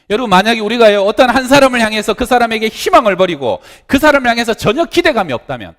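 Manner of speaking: high, loud, impassioned sermon voice; fervent preaching. Acted. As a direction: preach it hard to the congregation. Preaching at full force.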